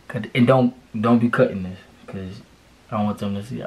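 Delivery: usual speaking voice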